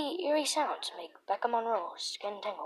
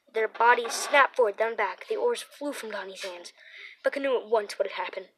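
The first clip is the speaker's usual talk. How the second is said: usual speaking voice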